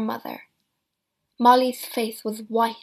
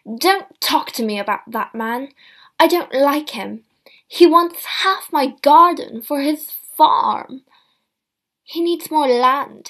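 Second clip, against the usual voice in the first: loud angry outburst; suddenly angry; 4 semitones higher, 10 dB louder than usual. A narrator's reading. Mother refusing to discuss her neighbour, angry. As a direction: snap at her daughter in anger. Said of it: "suddenly angry".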